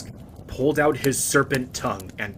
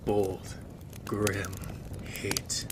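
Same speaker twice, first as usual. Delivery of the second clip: whisper; 6 dB quieter, slower than usual. Acted. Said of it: "unvoiced whisper".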